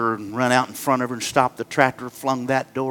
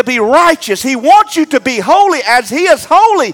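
raised preacher voice, driving each line; preaching; 9 dB louder than usual. Acted. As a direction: preach it to the altar call. Preacher at full force.

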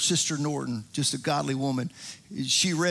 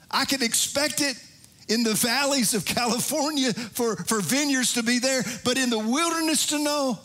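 raised, sung-along preaching over organ music; 5 semitones higher than usual. Acted. Pastor preaching to the congregation over music, fervent. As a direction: preach it out with rising fervor.

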